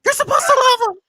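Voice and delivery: very high, loud mock tirade; hammed-up high-pitched shouting; Vicky impression, outraged. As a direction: shriek the accusations like a scandalized housewife. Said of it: "And then Vicky be like".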